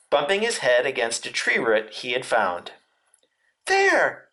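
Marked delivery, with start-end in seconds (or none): 3.6-4.3 high falling squeak; frightened Piglet. A narrator's reading. Piglet pointing out the heffalump, high frightened squeak. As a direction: squeak it out fearfully. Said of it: "said Piglet".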